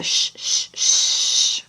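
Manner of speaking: whispered shh shh shh; shushing. Shown as a demonstration. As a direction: hush them with a whispered shh. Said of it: "play vocalizations".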